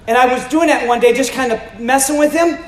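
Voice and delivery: normal talking voice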